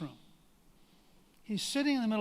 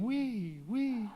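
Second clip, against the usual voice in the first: mild mock yell, pitched up, of a happy kid; gleeful kid yelling; 4 semitones higher than usual. Retelling Reagan's story, a kid in manure, mild mock gleeful yell. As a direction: lightly play the kid's delighted squeal.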